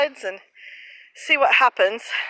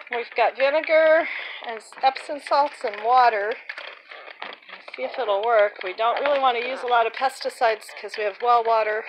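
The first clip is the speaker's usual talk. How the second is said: ordinary delivery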